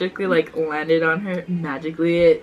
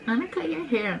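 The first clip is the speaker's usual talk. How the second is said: put-on bratty kid voice; 8 semitones higher, 5 dB quieter than usual. Quoting her sister as a kid.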